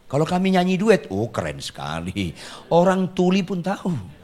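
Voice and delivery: ordinary delivery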